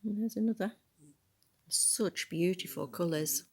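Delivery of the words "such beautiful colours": usual speaking voice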